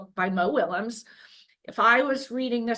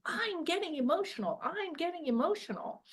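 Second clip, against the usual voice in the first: higher, softer cartoon pigeon voice; pigeon character voice; 8 semitones higher, 7 dB quieter than usual. Shown as a demonstration. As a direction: play the pigeon as a fretful cartoon bird. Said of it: "the pigeon is saying".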